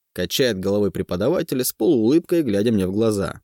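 plain reading voice

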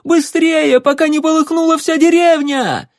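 high, loud panicked shout; urgent shout. Acted. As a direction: yell at everyone to hurry. Curly villager urging about fire.

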